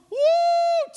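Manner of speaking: high, loud confident whoop; bold war cry. Retold or imitated. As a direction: whoop with full bravado. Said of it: "it goes from".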